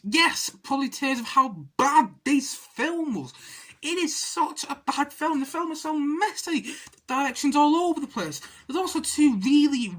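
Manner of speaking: high, emphatic film-critic rant; exasperated rant. Real, not acted. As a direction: complain in high-pitched exasperation. Ranting at how bad film is.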